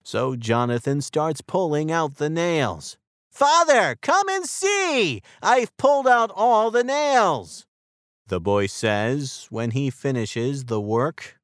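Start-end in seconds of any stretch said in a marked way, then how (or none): 3.3-7.7 high, loud eager calling out; boy calling his father to come and see; excited call. Acted. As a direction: call him over, proud of the finished job. Boy calls proudly to father.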